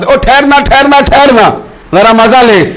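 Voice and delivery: high, urgent call from behind. Acted. Onlooker calls to a man working, urgent high call. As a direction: call out to halt someone at work. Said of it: "پیچھے سے کوئی دیکھنے والا آواز".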